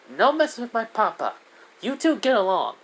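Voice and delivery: put-on high-pitched kid telling off the grown-ups; bossy little girl. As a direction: play a child laying down the law. Child Diane scolds the two adults.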